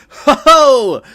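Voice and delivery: loud, high burst of laughter; booming ho ho laugh; hearty laugh. Real, not acted. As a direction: cackle out loud at your own joke. Big laugh after own joke.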